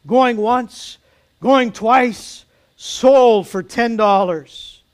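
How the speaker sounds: loud, high calls, drawn out on sold; auctioneer closing bids. A narrator's reading. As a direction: call out the final bids. Auctioneer closes the sale.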